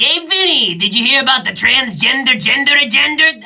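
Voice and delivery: lightly voiced 1920s gangster calling Vinny; mild 1920s mobster. Comic telling Vinny about the agenda in a light 1920s mobster accent. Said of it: "say it like you're a nineteen twenties mobster".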